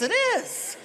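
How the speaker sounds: sudden high proud squeak; proud reply. Retold or imitated. Dad answering the manager about his son, high proud squeak.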